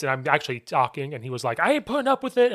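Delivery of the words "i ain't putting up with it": high-pitched mock outrage of an official; indignant ref. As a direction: imitate a ref putting his foot down. Retold angry ref confronting coach.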